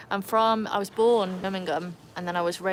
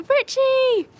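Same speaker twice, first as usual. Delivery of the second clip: very high, loud happy cry; excited shout; 11 dB louder than usual. Real, not acted. Streamer spots a friend arriving late, high excited shout. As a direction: shout the name in high-pitched delight.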